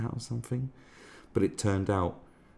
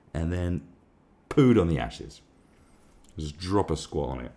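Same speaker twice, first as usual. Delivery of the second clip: ordinary talk, nothing marked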